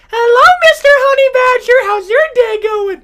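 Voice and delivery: very high, sweet, overly polite greeting; polite Mufasa. Acted. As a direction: chirp it out with fake politeness. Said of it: "Mufasa from The Lion King is like".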